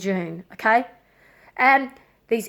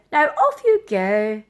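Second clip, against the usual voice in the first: slow, curt brush-off; dismissive; slower than usual. Retold or imitated. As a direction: send her away coldly. Staff dismissing her again.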